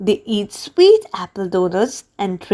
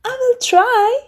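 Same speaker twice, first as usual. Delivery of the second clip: put-on much higher young cat voice; a high, hopeful kid-cat promise; Pete the cat; 13 semitones higher than usual. A narrator's reading. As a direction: lift the voice way up for little Pete. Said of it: "Pete says".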